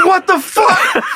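high-pitched disbelief through laughter; hammed-up outrage breaking into giggles; laughing mock outrage. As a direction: imitate an exasperated friend while cracking up. Acted angry friend, while laughing.

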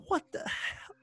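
high, hushed startled exclamation; high-pitched hushed disbelief; startled what the hell. Retold or imitated. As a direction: caught off guard mid-sprint, half under the breath. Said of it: "I'm like".

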